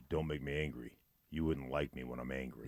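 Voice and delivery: low, soft mock menace; a hint of Bill Bixby's warning; Hulk quote. Quoting Bill Bixby from the Hulk, soft mock threat. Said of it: "where he's like".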